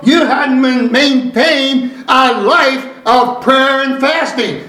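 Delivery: slow, deliberate pulpit delivery; measured preacher cadence with weighty stresses; emphatic preaching. Real, not acted. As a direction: drive each phrase home to the congregation. Preaches to the congregation.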